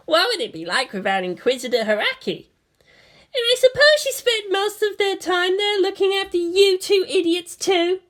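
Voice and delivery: put-on high young girl's voice, teasing; voiced bratty girl. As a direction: play the haughty pilot girl. Asuka voiced by narrator.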